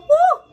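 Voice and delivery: loud, very high woo; real burst of delight; loud high whoop of excitement; excited whoop. Real, not acted. Tasting the hot soup.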